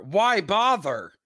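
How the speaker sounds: slow, high, stretched delivery; drawn-out title. Shown as a demonstration. Host proposing a new corner name, drawn-out announcement. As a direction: stretch out both words like a title.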